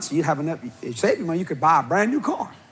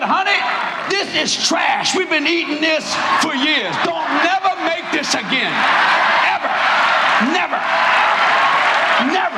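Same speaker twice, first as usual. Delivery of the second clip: higher, loud hammed-up scolding of his wife; husband banning the gumbo forever; 10 semitones higher, 4 dB louder than usual. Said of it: "He said".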